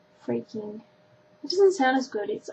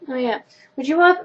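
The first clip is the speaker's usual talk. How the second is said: ordinary delivery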